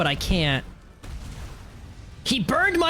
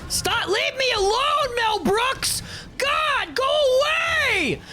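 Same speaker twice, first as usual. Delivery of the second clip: high frantic pleading shout, much higher; panicked yelling; 13 semitones higher, slower than usual. Real, not acted. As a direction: beg the monster to back off, screaming high. Fleeing monster, panicked yelling.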